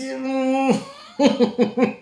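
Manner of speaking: high-pitched cackle; high laugh breaking out in bursts. Real, not acted. Speaker laughs mockingly at the politician, cackling.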